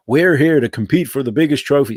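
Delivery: mock club statement. Mocking the club's stock line.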